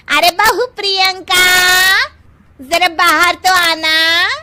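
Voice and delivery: normal talking voice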